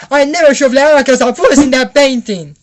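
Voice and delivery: loud, high-pitched complaint; acted indignant outburst.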